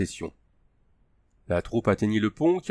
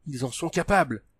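imploring; 5 semitones higher than usual. A narrator's reading. Raznar pleads with the Baptiste, imploring. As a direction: beg him to believe it. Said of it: "implora Raznar".

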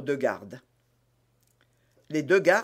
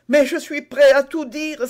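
higher, louder eager cry; exclamation empressée; 11 semitones higher, 9 dB louder than usual. A narrator's reading. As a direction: cry out, anxious to cooperate. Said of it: "s'écria Bonacieux". (s'écrier d'une voix aiguë et forte)

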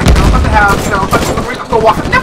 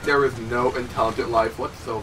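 low, trailing-off retold grumble; muttered disbelief; 8 semitones lower, 10 dB quieter than usual. Gamer recalls walking into class, muttered disbelief. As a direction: mutter it under your breath, sinking away.

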